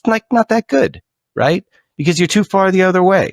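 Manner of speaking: usual speaking voice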